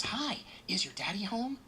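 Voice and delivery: put-on high, slow sugary voice to a child; sugary sweet voice. Agent talking to the four-year-old at the door, sugary sweet. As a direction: sweeten it right up for the little boy. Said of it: "this sugary, sweet voice".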